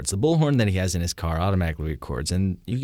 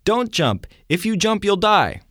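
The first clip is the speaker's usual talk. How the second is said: high, loud plea over a bullhorn; bullhorn shout; 12 semitones higher, 4 dB louder, slower than usual. Retold or imitated. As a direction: shout down a man on a bridge. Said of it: "gets out of the bullhorn and says".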